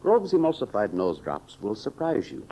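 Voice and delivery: ordinary delivery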